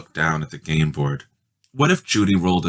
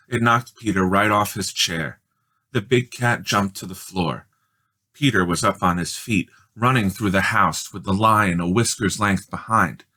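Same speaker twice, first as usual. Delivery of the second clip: plain reading voice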